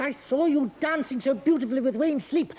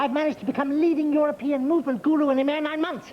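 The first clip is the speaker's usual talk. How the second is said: ordinary delivery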